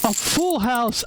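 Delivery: high-pitched cry of dismay; shocked outburst. Real, not acted. Poker streamer watching a full house beat his all-in, shocked outburst. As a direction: yelp it out in disbelief as the pitch shoots up. Poker hand suddenly turns against him.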